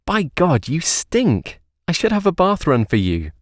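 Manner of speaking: lightly voiced lover, playful; playful Des. A narrator's reading. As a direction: tease him about the smell. Des teasing Ben.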